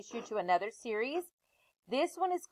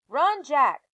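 high, louder shout to run; urgent call; 5 semitones higher, 7 dB louder than usual. Acted. Annie yelling at Jack to flee the monster, urgent shout. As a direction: yell it urgently at her brother.

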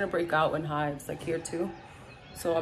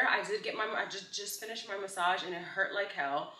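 ordinary talk, nothing marked

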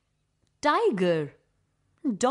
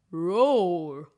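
a hammed-up roar, slightly louder; lion roar; 5 semitones lower, 3 dB louder than usual. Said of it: "a roaring lion".

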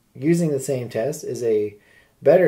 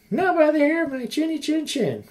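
high squeaky piggy voice; squeaky little pig; 14 semitones higher than usual. Acted. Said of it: "replied the third little pig".

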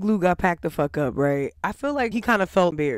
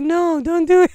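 lightly higher, louder Jonathan impression; mock Jonathan voice; 9 semitones higher, 6 dB louder than usual. Mocking the sweaty shirtless Jonathan's gentle protest. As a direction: poke fun at his sudden meek plea. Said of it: "where did this voice come from?".

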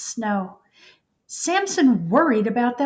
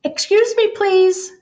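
high, louder call from a small mouse; 10 semitones higher, 5 dB louder than usual. Acted. Mouse in a snowdrift calls up to Samson, high polite call. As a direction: call up politely from under the snow. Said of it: "called a mouse".

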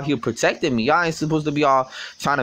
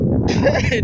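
high squeal of a laugh; 4 dB louder than usual. Real, not acted. Laughing at the listener.

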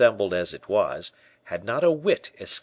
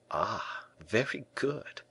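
soft, slow musing to oneself; inward thought; 7 dB quieter, slower than usual. A narrator's reading. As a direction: think it aloud, softly and slowly. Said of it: "thought D'Artagnan".

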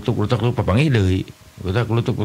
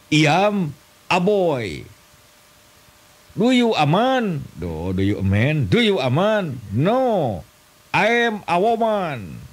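high, slow broken English; mock English drill; 6 semitones higher, much slower than usual. Comedian doing a silly English lesson, high slow broken English. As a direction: drill it like a clumsy schoolbook dialogue.

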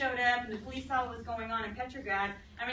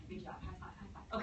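whispered mock congratulations; ひそひそ; 7 semitones higher, 13 dB quieter than usual. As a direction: whisper it like secret plotters. Plotters congratulate each other in hushed secret.

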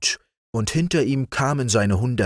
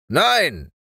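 high, falling "no"; mildly commanding sergeant's veto; sergeant's refusal; 10 semitones higher, 3 dB louder than usual. Acted. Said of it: "sagte der Wachtmeister".

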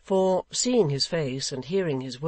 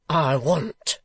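stern; 6 dB louder than usual. A narrator's reading. Stern father demands an answer.

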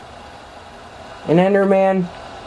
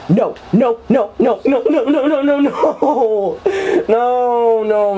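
laughing panic; 6 semitones higher than usual. Real, not acted.

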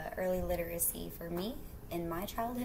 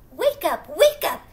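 loud, high wake-up call; sing-song loud wake-up call; 7 semitones higher, 12 dB louder than usual. Acted. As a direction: rouse the sleeping child. Mama waking sleeping llama.

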